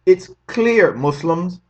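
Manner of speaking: ordinary talk, nothing marked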